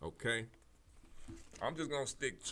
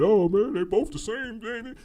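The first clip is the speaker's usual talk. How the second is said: higher, louder mocking voice of a lazy nonvoter; 12 semitones higher, 10 dB louder than usual. Mimicking people who won't vote, mocking higher voice. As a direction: parrot the whiny excuse up high. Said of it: "I heard that".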